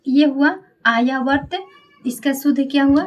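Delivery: usual speaking voice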